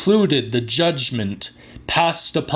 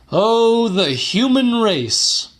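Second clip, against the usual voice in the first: lamenting outcry; 9 semitones higher, 4 dB louder than usual. Acted. Lecturer's lament to audience.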